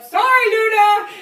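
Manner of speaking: high, drawn-out, very loud holler; yelling up. Retold or imitated. Hunter apologizing to the tree, long loud yell up. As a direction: shout the apology up to the treetop. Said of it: "one of the guys actually yelled up".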